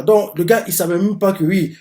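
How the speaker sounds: normal talking voice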